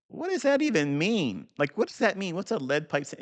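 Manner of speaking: puzzled self. Retold or imitated. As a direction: pitch way up in mock confusion. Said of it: "I'm like".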